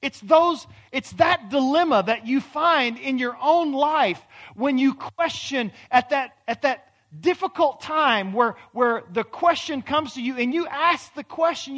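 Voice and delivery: high, loud preaching; preaching at full force. Acted. Preacher challenges the congregation, loud and high. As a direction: drive the questions home from the pulpit.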